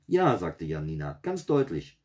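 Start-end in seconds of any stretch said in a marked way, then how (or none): 0.1-0.4 high, loud eager yes; emphatic girlish voice; eager child. A narrator's reading. Girl eagerly answers the cook. 1.2-2.0 put-on high, emphatic girl; girlish certainty, raised pitch; eager child. A narrator's reading. Girl insists she heard it.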